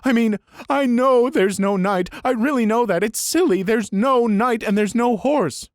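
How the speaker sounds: high, loud, tearful pleading; weepy insistence, slowing at the end. A narrator's reading. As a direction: keep crying through it. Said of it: "Elaine sobs".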